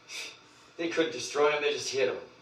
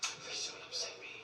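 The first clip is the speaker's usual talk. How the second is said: whispered plea; 13 dB quieter than usual. Real, not acted.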